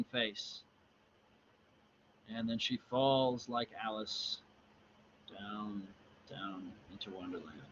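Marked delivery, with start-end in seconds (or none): 2.3-7.6 fading narration. A narrator's reading. Reader telling of a fall into Wonderland, fading softly. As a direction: let the voice fall away softly.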